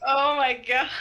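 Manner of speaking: laughing disbelief. Real, not acted. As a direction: laugh out the words. Laughing at blunt line.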